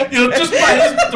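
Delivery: laughing while talking; words broken by laughter. Real, not acted. Laughing through talk.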